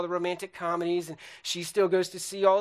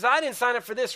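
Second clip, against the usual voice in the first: higher-pitched wife echoing back; mock angry retort; 6 semitones higher, 4 dB louder than usual. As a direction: act out a wife throwing it back. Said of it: "they're mad at each other".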